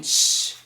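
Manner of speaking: a long hushing sh- with no voice; isolated sh sound. Shown as a demonstration. Teacher isolates the sh of sheen for learners. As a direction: hold the sh- sound alone. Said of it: "like shorts".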